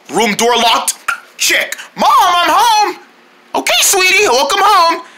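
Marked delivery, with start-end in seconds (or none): 2.0-3.0 high, drawn-out call through the house; raised-pitch homecoming call; calling to mom. Acted. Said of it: "Telling my mom that I'm home". 3.5-5.0 high-pitched mother calling back; high motherly call; mom's reply. Acted. Plays mom answering the call.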